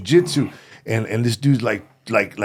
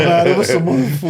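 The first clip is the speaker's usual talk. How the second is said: high, louder protest blaming someone else; mock-indignant excuse, pitched up; retold denial; 7 semitones higher, 5 dB louder than usual. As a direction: act out pleading your innocence. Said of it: "so I denied it".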